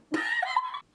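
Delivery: a high burst of stunned laughter; squealing laugh. Real, not acted. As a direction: shriek with laughter at the surprise. Stunned that people clapped.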